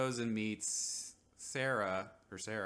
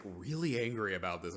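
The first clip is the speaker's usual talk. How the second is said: usual speaking voice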